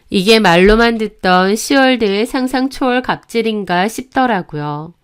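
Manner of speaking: usual speaking voice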